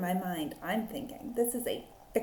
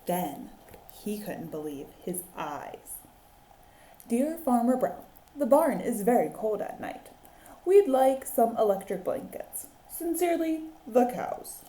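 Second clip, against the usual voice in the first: put-on lively read-aloud for children; animated picture-book reading; story narration; slower than usual. A narrator's reading. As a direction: tell it like a teacher at story time.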